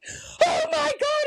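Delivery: very high, slow panicked cry; panicked squeak. Retold or imitated. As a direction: shriek as the toilet overflows. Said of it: "And I'm like".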